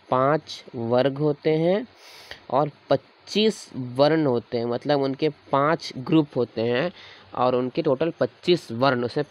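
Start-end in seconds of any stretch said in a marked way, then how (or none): 0.1-4.7 slow, deliberate dictation pace. Shown as a demonstration. Teacher dictating to students as they write, slowly. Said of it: "देखो ऐसे लिखो".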